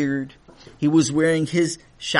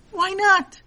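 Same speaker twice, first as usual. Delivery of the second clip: puzzled; 3 dB louder than usual. Acted. Man asks again, puzzled.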